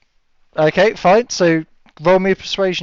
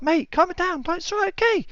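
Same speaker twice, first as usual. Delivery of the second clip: much higher and softer, soothing; calm placating mate; 5 dB quieter than usual. Acted. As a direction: talk him down gently. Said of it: "to you, it's just like".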